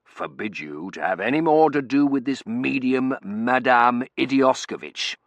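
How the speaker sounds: loud, blustering prohibition; forbidding colonel. A narrator's reading. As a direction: forbid it firmly, drawing out the name. Colonel forbids his wife.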